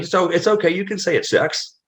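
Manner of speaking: pitched well up, teasing and amused; high mock-sympathetic wrestling boss voice; teasing Dutch. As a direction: play Dutch needling the new guy with a grin. Dutch teasing him about name.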